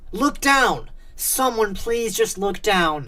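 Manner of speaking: high, loud inner plea; desperate scream. A narrator's reading. Alec silently pleading with the kids above him, desperate scream. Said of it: "His mind screamed".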